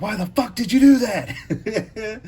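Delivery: high, loud, slow laughing yell; angry yell, laughing. Retold or imitated. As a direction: yell it through laughter at a friend. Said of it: "And he's like".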